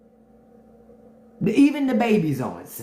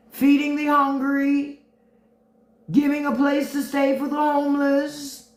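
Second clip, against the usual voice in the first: a light Trump impression, higher pitched; imitating Trump; 6 semitones higher than usual. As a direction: whine about Jesus stealing the spotlight. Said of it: "That's exactly how he would be".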